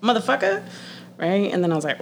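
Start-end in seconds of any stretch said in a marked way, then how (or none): 0.0-0.6 high, fast mock rant; frustrated outburst. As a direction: act out a fed-up dramatic resignation. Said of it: "I'm getting mad frustrated".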